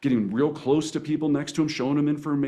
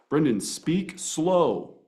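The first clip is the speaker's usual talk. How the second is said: a slowed self-reminder, said slowly; 2 semitones higher, much slower than usual. Retold or imitated. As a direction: read the note back slowly. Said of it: "I wrote down".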